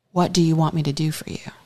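soft fast whisper; whispered question. Acted. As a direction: whisper it gently to him. Jesus's tender question retold.

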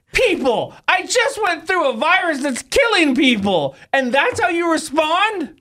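high, slow, shouted indignation; hammed-up outrage at the trolls; mock outrage. Mock outrage at mean tweets.

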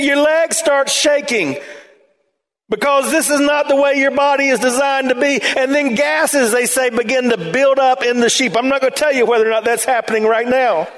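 high, impassioned pulpit voice; fervent preaching. Acted. Pastor preaching to his congregation about stuck sheep, fervent. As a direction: drive the point home to the pews.

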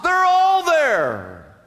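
very slow, all drawn out; all held long, falling; stress on all. Real, not acted. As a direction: draw out all. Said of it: "preacher stresses all".

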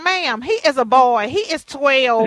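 mother answering the school's calls, loud and indignant; indignant mom retort. Retold or imitated. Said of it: "Because I was like".

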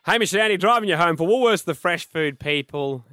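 loud, high sponsor read; radio announcer. Real, not acted. Host reopening the show for the sponsor, big announcer delivery. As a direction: belt out the show ident, stretching the slogan.

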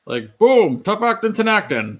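put-on loud, excited old-school announcer; John Madden impression. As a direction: boom it out like a football broadcaster. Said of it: "do, uh, John Madden".

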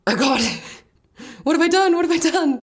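high, panicky oh God; panicked. Retold or imitated.

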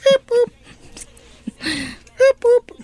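squeaky high beep boop. Pretend. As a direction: chirp it way up high. Doing the song's hook.

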